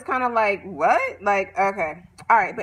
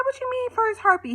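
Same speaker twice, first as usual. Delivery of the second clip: much higher, incredulous squeal of a question; shocked question; 11 semitones higher than usual. Retold or imitated. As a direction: catch him out in disbelief. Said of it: "She said".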